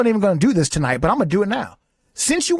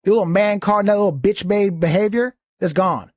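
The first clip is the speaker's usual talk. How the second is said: usual speaking voice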